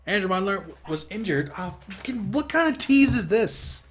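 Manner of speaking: high frustrated cry; exasperated outburst. Real, not acted. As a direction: wail at the unfair game.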